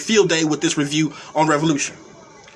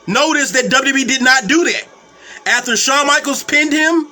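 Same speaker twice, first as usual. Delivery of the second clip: ordinary talk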